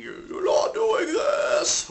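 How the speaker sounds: high, drawn-out whispered scream; loud, breath-only scream, stretched slowly; mock whispery scream. Shown as a demonstration. Coach showing screamers what to avoid, whispery scream. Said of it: "you're not gonna be whispering".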